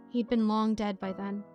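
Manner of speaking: normal talking voice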